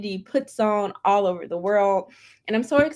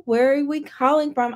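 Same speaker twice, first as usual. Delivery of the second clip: ordinary talk, nothing marked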